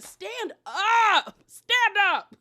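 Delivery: loud, high drawn-out plea; hammed-up urging, pitched high and loud; exasperated shout. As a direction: holler it like a fed-up friend. Urging Alexia to stand up.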